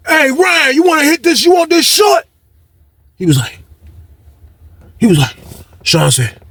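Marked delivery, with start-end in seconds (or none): 0.1-2.3 high-pitched call to a buddy; imitated calling out. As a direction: mimic someone calling over, voice way up. Sean calling to Ryan in story.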